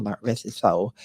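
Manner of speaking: ordinary delivery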